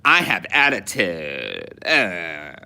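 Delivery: put-on slow, lightly done Valley Girl with attitude; a hint of a sassy Valley Girl. Describing the eighties girls' accent to the co-host, mild Valley Girl sass. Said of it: "That accent was just like".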